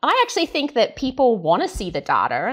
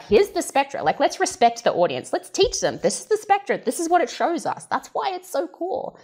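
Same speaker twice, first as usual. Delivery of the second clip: eager press team; 7 semitones higher than usual. Retold or imitated. Imagined press team eagerly teaching the audience, voiced higher. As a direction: sell the spectra like an enthusiastic presenter. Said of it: "the press team being like".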